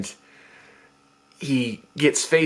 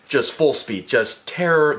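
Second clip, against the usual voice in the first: usual speaking voice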